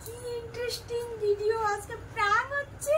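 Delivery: high, slow put-on excited voice; excited squeal.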